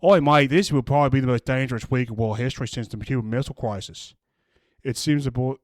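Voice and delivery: jokey Australian rewrite of a doom tweet; mock Australian accent. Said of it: "Rational Aussie".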